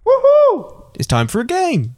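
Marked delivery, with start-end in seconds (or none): 0.0-0.8 loud, high cheer of joy; excited whoop. Real, not acted. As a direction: whoop it up for game time. Cheering in the game intro.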